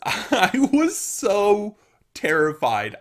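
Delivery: high, slow, laughing words; giggling confession of fear; laughing through it. Real, not acted. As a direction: laugh while you admit it. Laughing while recalling fear.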